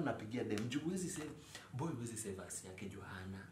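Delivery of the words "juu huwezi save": ordinary delivery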